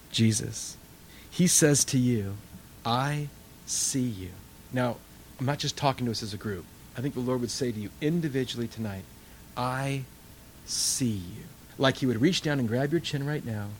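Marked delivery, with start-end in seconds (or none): 2.9-4.3 softer, slow and tender; tender whisper-soft. Acted. Lord speaking tenderly to listener. 9.6-11.4 softer, slow and tender; tender whisper-soft. Acted. Lord speaking tenderly to listener.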